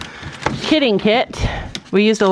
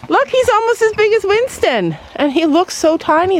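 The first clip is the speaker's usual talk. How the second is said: cooing; 10 semitones higher than usual. Real, not acted. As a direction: coo at him in a high voice. Cooing at the pig.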